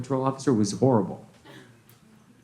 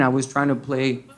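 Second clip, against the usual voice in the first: normal talking voice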